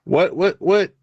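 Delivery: ordinary talk, nothing marked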